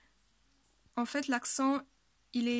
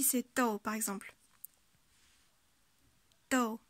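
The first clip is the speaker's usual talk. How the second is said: ordinary delivery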